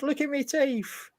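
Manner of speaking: bad English accent. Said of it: "appalling attempt at an English accent".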